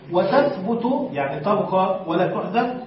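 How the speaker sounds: usual speaking voice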